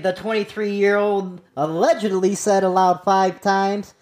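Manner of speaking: usual speaking voice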